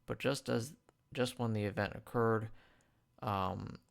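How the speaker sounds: ordinary talk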